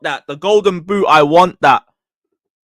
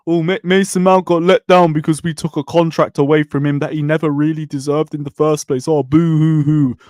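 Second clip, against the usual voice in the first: mock whining. Fan mimics complaints about Mason Mount, mock whining. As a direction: mimic a moaner with scornful fake sympathy.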